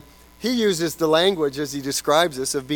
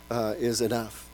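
usual speaking voice

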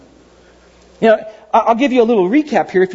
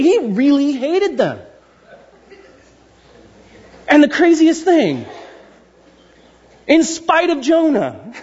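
usual speaking voice

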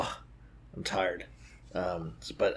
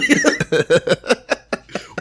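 high laugh; 8 semitones higher, 3 dB louder than usual. Real, not acted. Laughing after mock crying.

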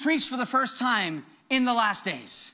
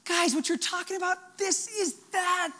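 raised pitch, slowing on the final words; excited revelation; 6 semitones higher, 3 dB quieter than usual. Retold or imitated. Telling dream coworkers what they are talking about, excited revelation. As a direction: stretch out the big reveal, urging them to see it. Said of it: "they're excited for the kingdom".

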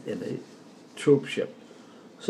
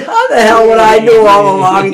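laughing; 9 semitones higher, 15 dB louder than usual. Retold or imitated. Mate's indignant reply, retold.

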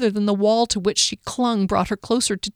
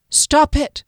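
sharp, frightened command, higher; 7 semitones higher, 4 dB louder than usual. A narrator's reading. Kier scolding dazed Fennel, sharp and frightened. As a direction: snap it to stop him. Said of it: "Kier said sharply".

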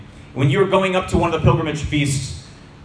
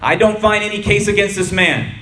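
raised, higher public declaration; Pilate addressing the crowd; 6 semitones higher, 3 dB louder than usual. A narrator's reading. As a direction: announce it firmly to a crowd. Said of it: "Pilate went outside again to the Judeans and told them".

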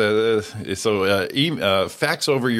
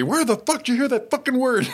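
high-pitched mock furious yell; imitated screaming dad. Bearded dad screaming at small son, retold in mock fury. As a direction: yell it as the outraged father. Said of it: "big bearded man face screaming at me".

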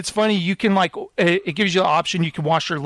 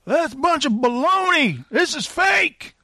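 pitched-up scornful protest; heckler in the audience; 9 semitones higher than usual. Acted. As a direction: call out the sham from the seats. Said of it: "this guy goes".

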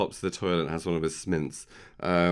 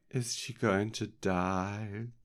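slow, drawn-out mock-worried question; lightly acted anxious voice; imitated worried mother; 3 semitones higher, 3 dB quieter, much slower than usual. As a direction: ask it slowly, playing the anxious mother. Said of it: "and she's like".

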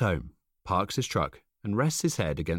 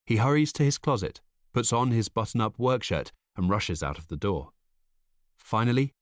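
ordinary delivery

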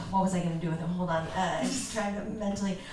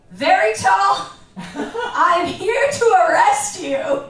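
much higher and louder, slow, a mock police raid; hammy cop bust; 10 semitones higher, 10 dB louder, slower than usual. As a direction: burst in as an over-the-top cop, laughing through it. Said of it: "little bit of acting I'm gonna do here".

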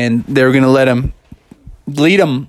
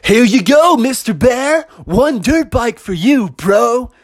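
high, punchy bro salesman; adventure center guy; 10 semitones higher, slower than usual. Acted. Employee handing Mister Bear his bike, hyped bro voice. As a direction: hand it over with hype-bro swagger. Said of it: "the Adventure Center guy started to roll out".

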